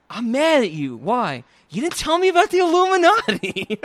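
high, loud hammed-up complaint; mock indignant. Mocking Lara's accusation to her father, loud and high. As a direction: whine it at your dad. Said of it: "I just wanna be like".

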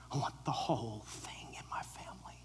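sudden hushed whisper. Acted. Jesus whispering he wants the whole world, hushed. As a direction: drop to an intimate whisper.

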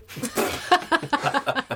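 high laughing burst; real short high laugh; burst of laughter. Real, not acted. Laughs at sister's remark.

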